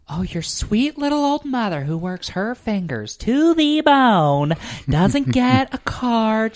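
high, loud, drawn-out guilt trip; hammed-up martyr mom, stretching the vowels; wounded mother. Mom who got no present, theatrically wounded. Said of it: "she's like".

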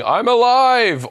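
high, drawn-out mock yell; mock scream. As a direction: shout it high and long, imitating a scream. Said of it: "just starts floating around screaming".

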